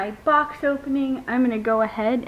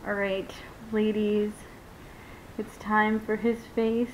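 ordinary delivery

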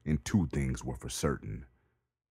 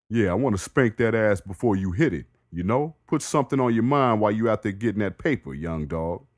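higher, louder trash-talking neighbor; Jamal voice; 6 semitones higher, 6 dB louder than usual. Acted. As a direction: taunt him, quick and cocky.

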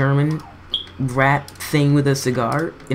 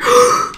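startled high gasp; sharp high gasp; 5 dB louder than usual. Real, not acted. Gamer nearly hit by a boss attack, sharp gasp. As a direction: gasp at a near miss.

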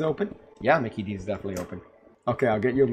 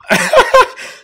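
airy, much higher wheezing laugh; breathless laugh; 13 dB louder than usual. Real, not acted. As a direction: burst out in a silent wheeze. Laughs after door surprise.

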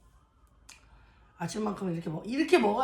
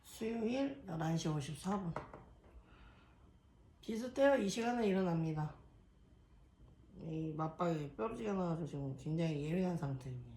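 calm narrated voiceover; vlog voiceover; 3 semitones lower than usual. Acted. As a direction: read it like a diary caption.

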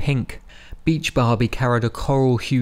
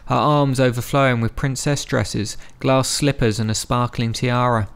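ordinary delivery

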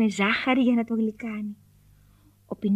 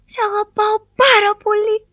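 puppet voice; 8 semitones higher, 5 dB louder than usual. A narrator's reading. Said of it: "είπε ο Πινόκιο στην νεράιδα".

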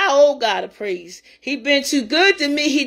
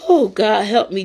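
ordinary talk

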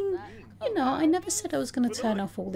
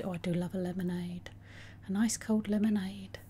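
whisper; 3 semitones lower, 6 dB quieter than usual. Real, not acted. Savouring aside.

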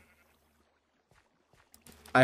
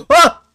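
shocked gasping cry; 16 dB louder than usual. Real, not acted. Bee attacks, shock.

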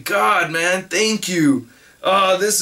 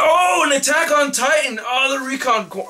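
excited; 7 semitones higher than usual. Real, not acted. Spots a favourite series in box.

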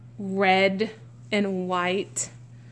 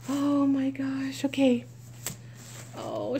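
normal talking voice

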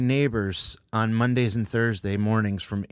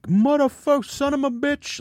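much higher mock swearing of an old man; 13 semitones higher than usual. Neighbor cursing at birds, imitated much higher. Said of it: "old-time mumble swearing".

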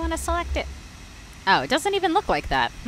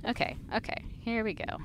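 ordinary talk, nothing marked

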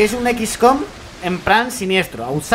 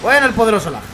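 louder, higher mock herald; mock proclamation; 4 semitones higher, 4 dB louder than usual. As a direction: hail the lag like a king. Mock-hailing the lag.

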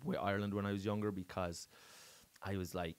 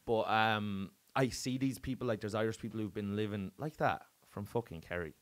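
ordinary talk, nothing marked

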